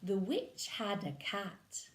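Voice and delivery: lively storybook narration; reading to children. Acted. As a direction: read it like bedtime to little ones. Reading picture book aloud.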